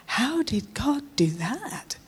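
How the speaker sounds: slow, breathless whisper in disbelief; whispered, stunned, slowed right down; awed whisper. Retold or imitated. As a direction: hush it out in amazement. Stunned after near crash.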